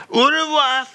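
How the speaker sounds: hammed-up farewell. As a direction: fling out au revoir theatrically. Repeated farewell.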